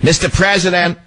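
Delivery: high yelled call to the president; mock reporter yell. Reporter calling out to the president, mock yell. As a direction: shout it like a pushy reporter. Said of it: "Remember he made his name with that?".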